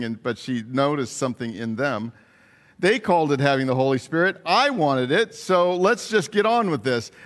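ordinary delivery

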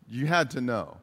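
ordinary talk

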